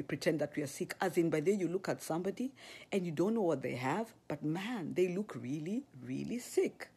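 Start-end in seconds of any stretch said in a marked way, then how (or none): none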